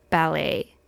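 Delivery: normal talking voice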